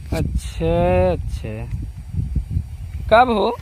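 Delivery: usual speaking voice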